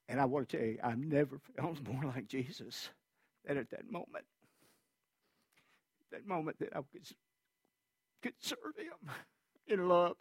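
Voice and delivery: weeping. Real, not acted. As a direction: let the tears break through. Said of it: "I don't know why I'm weeping".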